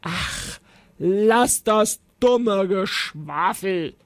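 slow, munching dragon; annoyed dragon talking while eating; smacking, mouth full. A narrator's reading. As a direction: grumble through a mouthful. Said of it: "schmatzte der Drache".

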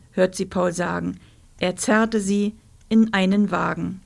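plain reading voice

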